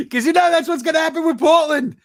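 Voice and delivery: high, loud sardonic outburst; mock dismay. Real, not acted. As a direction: scoff at the obvious outcome. Mocking Portland's fate.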